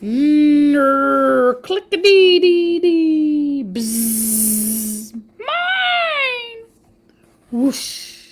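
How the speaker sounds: playful buzz, clicks and a high squeaky cry; buzzing insect noises. Acted. Reading the wasp's approach, silly insect sound effects. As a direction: mouth the bugs' drone then squeal mine.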